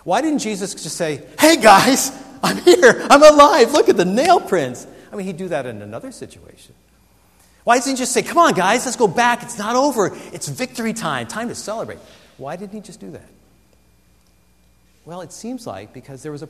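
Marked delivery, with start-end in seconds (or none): 1.4-4.9 loud, high, excited call to friends. Acted. Jesus imagined calling out joyfully. 8.2-12.0 upbeat rallying. Acted. Jesus imagined rallying disciples.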